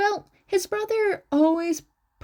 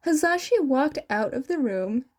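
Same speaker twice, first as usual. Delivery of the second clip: ordinary delivery, nothing marked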